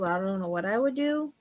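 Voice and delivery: usual speaking voice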